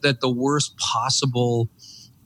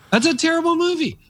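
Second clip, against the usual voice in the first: much higher, exaggerated disbelief; mock outrage. Real, not acted. Mocking a film about a perfectly happy day, high mock horror.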